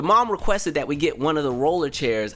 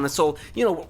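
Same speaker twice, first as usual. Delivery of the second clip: ordinary talk, nothing marked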